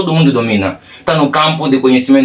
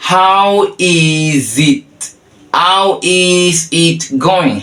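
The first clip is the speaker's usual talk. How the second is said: very slow, stretched words, higher; slow demonstration; 4 semitones higher, much slower than usual. Shown as a demonstration. Teacher introduces the third way of asking, slow demonstration. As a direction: hold each word for the learner.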